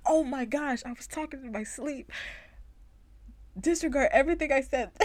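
high, loud and fast mock panic; embarrassed panic. She realizes she sleep-talked, hurried embarrassed panic. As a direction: blurt it out in flustered alarm.

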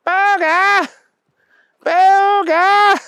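high-pitched insistent shout; Keith calling out. A narrator's reading. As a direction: call him back to his senses, loudly. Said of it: "he heard Keith's voice going".

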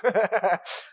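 a short real laugh. Real, not acted. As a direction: laugh at yourself. Laughing at own story.

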